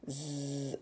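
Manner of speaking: "Z" as a long buzzing zzz; letter sound of Z. Shown as a demonstration. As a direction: hold the buzz of "Z", not the letter name. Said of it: "le son ze".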